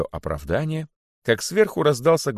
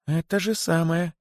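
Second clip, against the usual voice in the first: squeaky; 9 semitones higher than usual. A narrator's reading. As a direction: squeak out a timid admission. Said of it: "пропищал находчивый". (пискляво, тонким голоском)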